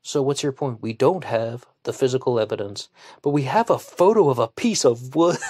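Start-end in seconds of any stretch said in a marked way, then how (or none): 3.2-5.4 higher, louder, cracking up by the end; incredulous, breaking into a laugh; laughing in disbelief. Real, not acted. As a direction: say it as real laughter takes over. Incredulous laugh at the flimsy evidence.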